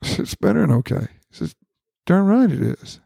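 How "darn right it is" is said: retold God, pitched higher; lightly voiced imitation of God's reply; God's way of talking. Man retelling God's reassurance, a light higher imitation. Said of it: "he talks like that".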